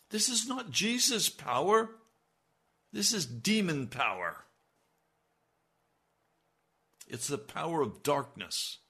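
high, loud, ringing preacher's cry; preaching at full force. Acted. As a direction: thunder it from the pulpit. Preaching against wicked power.

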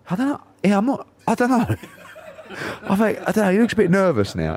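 very high, squeaky uncertain voice; retold high-voiced dithering; high-pitched unease. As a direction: squeak it out, unsure, with a nervous laugh. Retells his nervous answer to dad.

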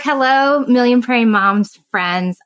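ordinary delivery

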